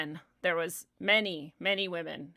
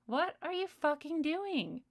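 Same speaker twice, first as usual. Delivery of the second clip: incredulous retort; 8 semitones higher than usual. Retold or imitated. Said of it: "And you're like".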